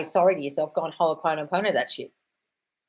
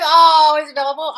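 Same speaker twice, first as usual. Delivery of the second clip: mock excited; 9 semitones higher, 11 dB louder than usual. Mock excited reaction to comment.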